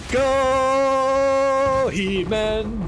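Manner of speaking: loud high cheer for the hero; cheering shout. Real, not acted. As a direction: shout him on like a cartoon hero. Cheering on a big drop.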